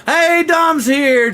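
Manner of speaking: high, drawn-out hey; excited arrival call. Real, not acted. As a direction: stretch the greeting out way up high. Announcing an arrival in chat.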